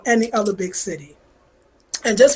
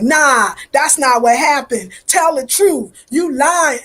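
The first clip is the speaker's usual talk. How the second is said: sharp, raised call-out, slowed at first; confronting boyfriend; 4 semitones higher, 5 dB louder, slower than usual. Retold or imitated. A relative calling her man a liar, sharp and raised. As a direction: call out his lie, a bit higher and louder. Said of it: "she'd be like".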